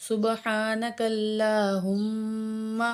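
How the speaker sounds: اللہم with the doubled meem held and nasalised; very slow, ghunna on اللہم; nasal ghunna on meem. Shown as a demonstration. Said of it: "ہم کے میم میں ہم غنہ کریں گے".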